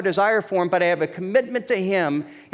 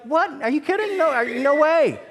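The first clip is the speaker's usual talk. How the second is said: high-pitched, half-laughing incredulity; mock disbelief; 10 semitones higher than usual. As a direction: act out a stunned groom refusing outright. Imagined groom's reaction to the confession.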